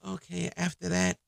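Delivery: hushed, put-on coy voice; soft coy request. Woman easing into a request to her man, mock coy. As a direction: play the lady asking softly.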